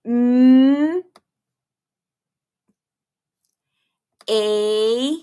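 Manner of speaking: "m, a, k" held long with big gaps between; separate phonics sounds. Shown as a demonstration. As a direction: hum a long "m", stretch "a", then a crisp "k". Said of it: "tap it out".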